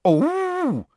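very high mocking creature voice; mock it in a high squeal, falling at the end; high, put-on sarcastic gargoyle; high-pitched gargoyle. A narrator's reading. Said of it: "in a high-pitched voice".

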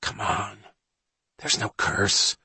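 soft, slow, dismissive whisper; hushed disdain; scornful whisper. Acted. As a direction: brush off the fear scornfully under your breath. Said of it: "whispered Johnny scornfully".

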